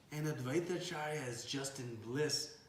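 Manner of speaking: ordinary talk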